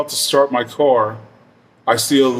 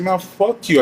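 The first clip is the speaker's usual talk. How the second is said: defiant comeback; 7 semitones higher, slower than usual. Retold or imitated. Retold quarrel, his defiant comeback.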